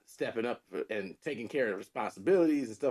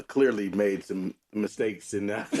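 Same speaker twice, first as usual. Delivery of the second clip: ordinary talk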